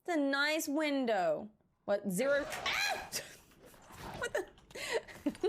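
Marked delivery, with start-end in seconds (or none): 2.7-3.1 startled yelp. Real, not acted. Sudden shock in game.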